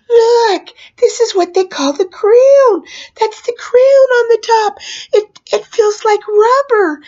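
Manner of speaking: high, loud, urgent explaining; acted frantic reassurance. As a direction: insist over the screaming that all is fine. Reassuring screaming kids, retold.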